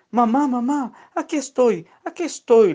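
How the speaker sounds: sehr hohes, lautes Rufen; Schrei. Acted. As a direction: ruf freudig nach der Mama. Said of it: "le gritaba".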